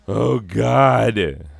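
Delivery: shaken relief. Real, not acted. After the in-game danger passes.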